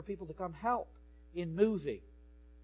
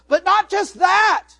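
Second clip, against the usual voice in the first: full-force preacher shout; 13 semitones higher, 17 dB louder, slower than usual. Real, not acted. Preacher shouting to his congregation, loud and slow.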